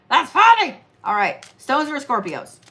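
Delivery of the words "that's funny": higher and louder that's funny; amused insistence. Real, not acted. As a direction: insist it's a joke, laughing. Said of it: "Come on, that's funny".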